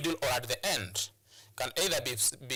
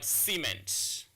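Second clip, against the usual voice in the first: CEMENT said slowly as SEE-ment, first syllable stressed; noun-style "cement", weight up front. Shown as a demonstration. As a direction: lean on the opening syllable of "cement". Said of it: "When you pronounce that word as a noun".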